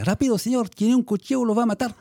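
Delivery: put-on a little boy yelling a warning while running; voicing the boy. Teacher voicing the nine-year-old boy warning him, high and urgent. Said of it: "En espagnol, c'est".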